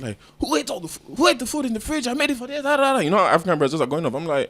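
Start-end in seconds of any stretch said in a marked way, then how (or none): 0.4-3.0 high-pitched scolding, kept at ordinary volume; imitated yelling mom. Mom demanding who ate the food, mock yelling. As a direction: rattle off a mock telling-off up high. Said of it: "my mom just starts yelling".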